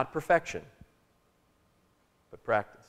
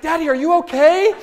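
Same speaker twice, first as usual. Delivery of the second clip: put-on much higher, louder small-child voice, worried; little daughter; 13 dB louder than usual. A little girl seeing her dad burst into tears, worried high voice. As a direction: play a four-year-old alarmed for her dad. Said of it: "And she was like".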